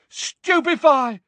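high, loud, drawn-out incantation; shouted spell. A narrator's reading. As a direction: yell the spell with force. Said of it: "Neville shouted again".

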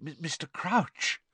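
high, slow, stammering awe; breathless. A narrator's reading. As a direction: hesitate breathlessly on the name. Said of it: "said Percy breathlessly".

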